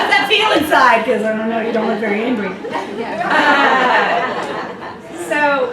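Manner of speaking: loud, laughing mock question; teasing, laughing hard at a calm friend; loud laughing tease; laughing through it. Mocking friend's mild anger, laughing.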